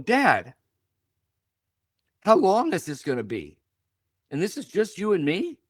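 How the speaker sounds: high, rising "And this is just you and me?"; mock alarm at a road trip with dad; incredulous son. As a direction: replay the stunned teenager, voice climbing. Young self questioning his dad.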